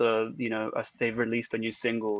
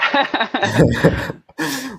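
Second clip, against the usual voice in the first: laughing; 3 semitones higher, 7 dB louder, much faster than usual. Real, not acted.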